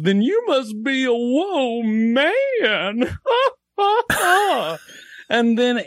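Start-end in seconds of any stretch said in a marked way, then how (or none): 0.0-3.2 very high, drawn-out clown punchline. Acted. Clown mocking the dad before the crowd, high and slow. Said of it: "the clown goes". 3.8-4.8 very high mocking clown cackle; high clown laugh. Clown laughing at the mortified dad, high cackle.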